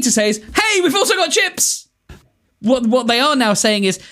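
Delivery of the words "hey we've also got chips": high-pitched, cheery proclamation; excited mock sales cry; enthusiastic announcement. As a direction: announce it like great news. Enthusiastic sign, acted announcement.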